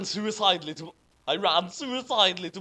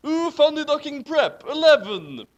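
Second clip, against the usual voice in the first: louder, higher ooh, then bellow eleven; hammed-up excited cry; 10 semitones higher, 9 dB louder than usual. Reading the fanfic insult aloud, hammed-up yell. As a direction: belt it out like a fed-up heroine. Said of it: "I'm going to say funny ducking as well here".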